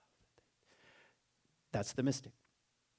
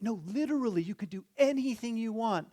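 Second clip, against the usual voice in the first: much higher, emphatic champion voice; insistent winning athlete; 10 semitones higher than usual. Acted. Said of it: "they're interviewing a guy".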